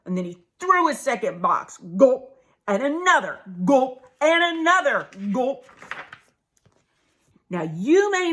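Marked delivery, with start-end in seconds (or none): 2.0-2.4 loud mock swallowing gulp. Turtle swallowing the sody, gulping loudly. 3.6-4.0 loud mock swallowing gulp. Turtle swallowing the sody, gulping loudly.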